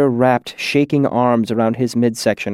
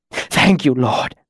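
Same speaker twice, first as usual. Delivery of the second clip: earnest gasp; slower than usual. A narrator's reading. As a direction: gasp out thanks, spent from pain. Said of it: "he gasped out earnestly".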